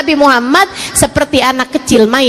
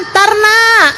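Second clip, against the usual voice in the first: high, drawn-out called reply; mother calling back; 8 semitones higher than usual. Acted. Bathing mother putting off her child, calling back. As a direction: call out to put the child off.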